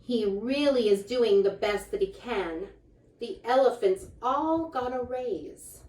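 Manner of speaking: lively, sing-song picture book reading; reading to children. A narrator's reading. Reading a rhyming book aloud to children, lively. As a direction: read the rhymes out brightly to the kids.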